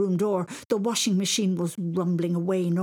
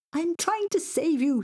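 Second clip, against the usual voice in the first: put-on very high frantic boy; high-pitched, flustered yelp; confused scream; 12 semitones higher, 3 dB louder than usual. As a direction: cry out in bewildered panic. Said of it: "he screamed, very confused".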